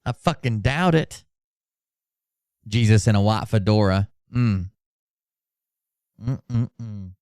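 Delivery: ordinary talk, nothing marked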